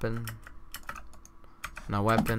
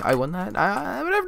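usual speaking voice

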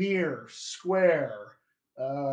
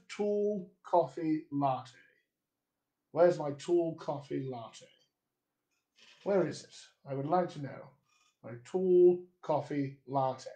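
softer British RP, measured and deliberate; RP accent; 4 dB quieter than usual. Shown as a demonstration. Coach demonstrating where's my latte for students, British RP accent. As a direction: put on RP, close rounded 'aw' in tall, short 'o' in coffee. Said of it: "If I was doing an R P accent".

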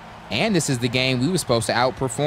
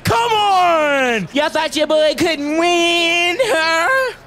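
much higher, loud triumphant yells; victory screaming; 6 dB louder, much slower than usual. Real, not acted. Gamer celebrating a win with triumphant screams. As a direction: scream it like you just won it all. Winning the game.

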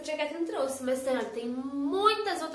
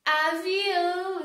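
stretched word; 5 semitones higher, 3 dB louder, slower than usual. Shown as a demonstration.

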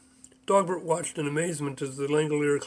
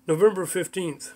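ordinary delivery

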